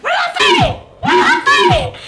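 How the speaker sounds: very high, loud squealed shout; mock screamed protest. April protesting to lobby staff, screaming shrilly. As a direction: imitate a frantic shriek.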